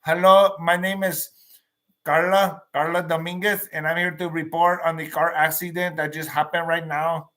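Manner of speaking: mock local TV reporter with an El Paso accent; El Paso newscaster. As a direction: announce the crash like a local El Paso anchor. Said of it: "the voice of El Paso".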